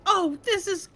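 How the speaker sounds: put-on high, fast, breathless little girl voice; excited little girl. Granddaughter to grandma about the car seat, high excited child voice. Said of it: "And then she's like".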